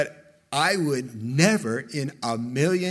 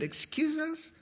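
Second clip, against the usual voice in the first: imitating taken-aback objectors; mock incredulous; 12 semitones higher, 4 dB quieter than usual. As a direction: act out offended disbelief, pitched way up.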